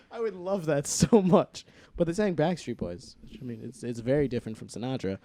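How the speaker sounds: normal talking voice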